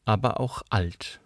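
usual speaking voice

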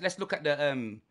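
normal talking voice

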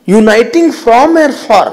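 "from" and "for" punched loud, high and drawn out; slow phrase, hit "from" and "for" hard; stress on FROM and FOR. Real, not acted. Preacher teaching a key phrase, stressing "from" and "for".

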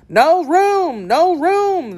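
high-pitched storybook cry. A narrator's reading. Tea party spots Alice coming, crying out high. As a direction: call it out high, turning a newcomer away. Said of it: "they cried out when they saw Alice coming".

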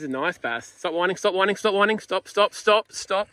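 loud, rapid orders while landing the fish; urgent loud commands. Real, not acted. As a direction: shout instructions to stop winding. Urgent instructions landing big fish.

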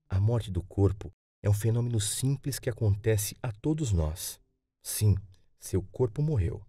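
ordinary delivery

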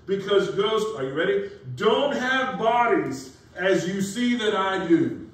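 high, loud, slow preaching; fiery preaching. Acted. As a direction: thunder it from the pulpit. Preaching Jesus' words at full force.

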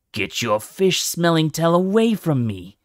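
high, loud snarl at a rival; angry shout. Acted. Dragon snarling at a SeaWing in a crowded hall, angry shout. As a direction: bark it like a furious dragon in a brawl.

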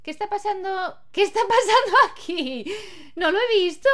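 startled what-is-going-on; baffled. Real, not acted. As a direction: cry out in amused confusion. Baffled at Sims' sudden fight.

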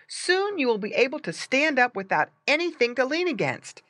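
a hint of an eager boy coaching; boy encouraging. A narrator's reading. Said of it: "cried Bob".

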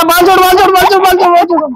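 high, playful begging to let go; mock pleading. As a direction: beg the kid to let go of your hair, up high. Kid pulling parent's hair. (बच्चे से बाल छुड़ाने की ऊँची, नाटकीय मिन्नत)

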